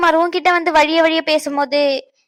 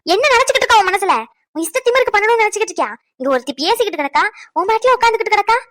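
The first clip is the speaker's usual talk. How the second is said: angry berating; 4 semitones higher, faster than usual. Acted. Mother berating her daughter over her attitude, angry and fast. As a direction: fire the accusations at her in a rush.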